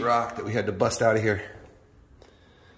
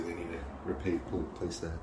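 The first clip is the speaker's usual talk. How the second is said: stumbling over the word; stuttering; 5 semitones lower, 13 dB quieter than usual. Real, not acted. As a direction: trip over repa- replace. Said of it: "I'm stuttering".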